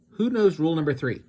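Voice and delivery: loud, fast quiz question; eager teacher. A narrator's reading. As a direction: fire the question at the class. Teacher quizzing the class.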